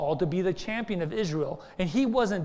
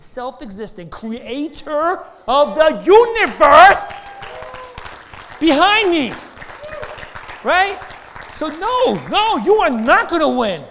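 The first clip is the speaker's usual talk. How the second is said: loud, much higher fervent proclamation; preaching at full force; 9 semitones higher, 14 dB louder, slower than usual. Real, not acted. Preacher declaring God behind him, loud and high. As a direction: shout it with full conviction. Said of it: "preaching climax".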